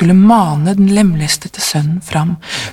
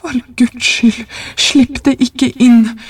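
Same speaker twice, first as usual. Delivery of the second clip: slow, raised-pitch fearful plea; trembling cry; 6 semitones higher, slower than usual. A narrator's reading. Old man begs wife not to let it in, trembling plea. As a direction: beg in dread, stretching out the words. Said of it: "ropte den gamle mannen skjelvende".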